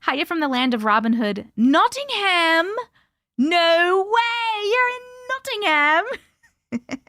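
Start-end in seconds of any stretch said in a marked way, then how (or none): 1.6-7.0 higher, squealing "no way"; excited disbelief. Real, not acted. To a viewer from Nottingham, squealing with delight. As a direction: leap up in delighted surprise. Viewer reveals her town.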